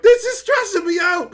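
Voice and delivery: high, loud stressed-out shout. Retold or imitated. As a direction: let the stress burst out. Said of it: "But I'm like".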